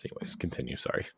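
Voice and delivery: unvoiced, quick apology aside; whispered aside. Real, not acted. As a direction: drop to a whisper to move on. Hushed aside after tangent.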